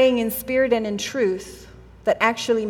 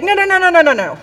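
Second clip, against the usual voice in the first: high, loud rush of objections falling in pitch; mock-frantic backpedalling; comically alarmed, cutting him off; panicked refusal; 6 semitones higher, 5 dB louder, faster than usual. Acting Martha's hasty backpedalling.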